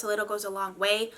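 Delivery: ordinary delivery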